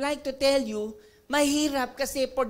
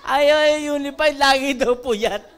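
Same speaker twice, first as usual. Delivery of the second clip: laughing retelling; 10 dB louder, slower than usual. Retold or imitated.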